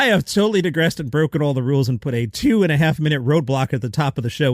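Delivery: ordinary talk, nothing marked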